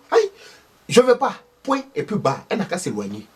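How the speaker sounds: voix aiguë et forte de vieille dame indignée; belle-mère accusatrice. Acted. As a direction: une belle-mère qui dénonce une sorcière. Thérèse accuse Akissi de sorcellerie. (Thérèse accuse Akissi, voix aiguë indignée)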